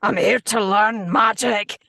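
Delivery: slow, loud, hammed-up wrecked-voice student; Bea Arthur. Recalling a failed student audition after wrecking the voice, hammed-up impression. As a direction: announce it like a badly miscast student. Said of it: "Bea Arthur, just going".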